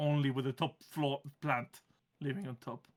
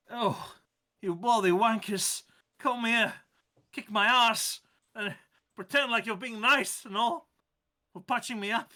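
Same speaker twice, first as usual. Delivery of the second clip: higher, rough British plant voice; British accent; 6 semitones higher, 5 dB louder than usual. Acted. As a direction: grumble it like a cranky Brit. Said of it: "changed accents midway".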